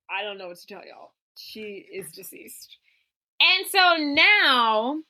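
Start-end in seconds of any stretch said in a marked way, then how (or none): 0.1-2.8 deadpan Courtney. Retold or imitated. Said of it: "Courtney's like".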